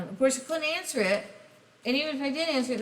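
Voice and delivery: ordinary talk, nothing marked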